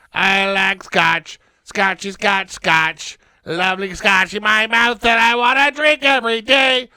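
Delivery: loud, drawn-out love for scotch; hammy excitement. Acted. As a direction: gush over the drink.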